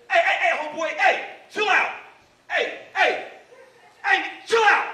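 loud, high, urgent heys; acted calling out. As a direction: call across a parking lot to stop him. Calling out to stop the attacker.

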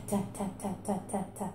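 soft repeated ta syllables; demo ta sounds. Shown as a demonstration. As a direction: tap out ta ta ta quietly. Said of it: "we're gonna say ta".

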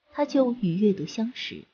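ordinary delivery

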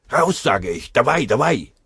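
loud, rising commanding yell; shouted order. A narrator's reading. Hähnel shouting at the Russian to get moving, loud orders. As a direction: drive him out with your voice.

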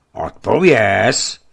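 loud, slow insistent command; agitated official pressing the prisoner; demanding shout. A narrator's reading. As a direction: force the answer out of him. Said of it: "zasypal posadník".

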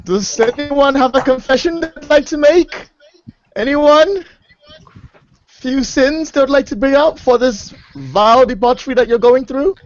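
high-pitched acted priestly appeal; priest calling for confessions. Priest to a pirate shack, acted call to confess. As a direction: pitch it up, addressing a whole room.

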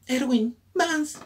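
voz aguda y lenta de personaje; voz de personaje fallida. Acted. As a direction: Erwin dicho muy agudo y lento. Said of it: "¿por qué pongo esta voz". (voz impostada aguda)

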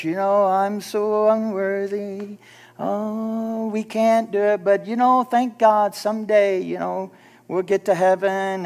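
slow, droning mock-pious whine; hammed-up drawn-out self-pity; mock unworthy whining. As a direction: drag it out like a woeful church-goer. Mocking unworthy church singers.

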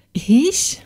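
startled gasp of a word; stammered. A narrator's reading. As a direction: blurt out a shocked question. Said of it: "stammelte sie".

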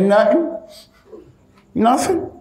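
high, questioning denial; mock-innocent wife caught out; playing innocent. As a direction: feign innocence, voice lifting at the end. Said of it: "She said".

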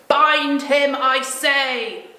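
loud commanding witch; witch's order. Acted. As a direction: bark the order at the hags.

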